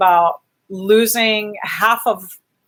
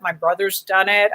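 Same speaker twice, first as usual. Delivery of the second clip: normal talking voice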